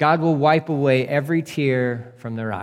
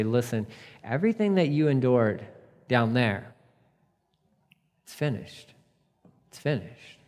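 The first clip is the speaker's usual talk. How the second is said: tender voice of God; 3 semitones lower, 5 dB quieter than usual. Retold or imitated. God embracing believer in heaven.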